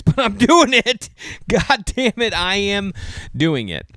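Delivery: loud, slow, high mock complaint; mock exasperation. Real, not acted. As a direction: laugh it off with a groan. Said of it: "self-mocking about Sunday shows".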